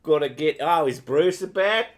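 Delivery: loud, high Aussie caricature; broad put-on Aussie accent; mock Australian accent. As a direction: ham up an Australian calling on mates. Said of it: "you do Aussie well".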